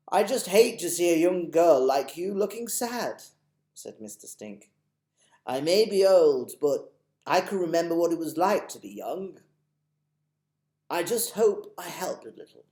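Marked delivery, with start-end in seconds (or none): 0.1-3.2 put-on higher, louder old man, reassuring; a lightly voiced old tramp comforting her; kindly old tramp. Mister Stink reassuring. 5.5-12.7 put-on higher, louder old man, reassuring; a lightly voiced old tramp comforting her; kindly old tramp. Mister Stink reassuring.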